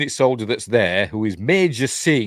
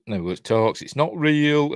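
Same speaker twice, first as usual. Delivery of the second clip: normal talking voice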